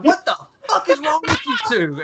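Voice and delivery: high, shouted mock outrage; exasperated high-pitched yell, retold; acted angry officer. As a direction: imitate an officer exploding at recruits. Angry officer berates two recruits.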